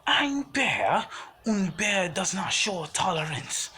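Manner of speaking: high, mock German accent, slowing down. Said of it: "in a stereotypical German accent".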